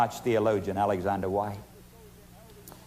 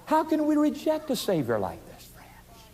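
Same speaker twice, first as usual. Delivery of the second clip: pleading whisper; 12 semitones higher than usual. Acted. Preacher pleading, ending in whisper.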